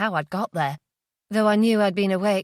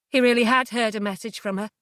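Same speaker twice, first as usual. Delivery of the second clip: usual speaking voice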